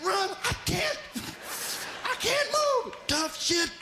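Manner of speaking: high, squeaky frightened animal voice; panicked gazelle. Acted. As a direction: squeal it like a gazelle that can't run.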